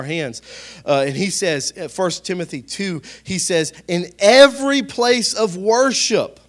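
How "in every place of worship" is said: high, slow, declamatory verse reading; emphatic scripture reading. Acted. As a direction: proclaim the apostle's words, stretching each phrase.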